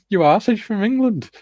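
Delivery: high and giggly, teasing; laughing teasing retort. Retold or imitated. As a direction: tease through a laugh.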